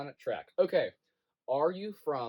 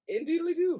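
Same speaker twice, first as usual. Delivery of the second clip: high-pitched mock-refined lilt; fancy voice; 12 semitones higher, 4 dB louder than usual. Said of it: "All fancy people have a fancy voice".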